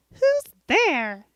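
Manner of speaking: high, loud mock exasperation; really irritated. Listener answering yet another knock knock, mock irritated. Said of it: "they're getting really irritated with you".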